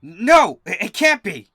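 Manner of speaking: high, loud cry of horror; father bull reads his daughter's name, horrified and loud; horrified gasp. A narrator's reading. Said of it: "then gasps in horror".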